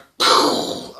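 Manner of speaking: loud breathy rushing noise; a breathy whoosh of fire; imitated whoosh. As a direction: make the sound of everything bursting into flame. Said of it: "and it just went".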